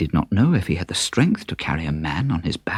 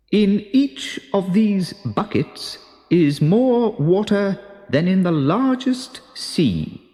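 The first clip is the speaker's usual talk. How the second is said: much higher, slow, strange magician voice; magician Melchior; 11 semitones higher than usual. A narrator's reading. Magician shows the king and donkey magic buckets, slow and eerie. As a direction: unveil the magic like a mysterious wizard.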